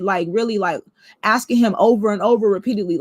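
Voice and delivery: plain reading voice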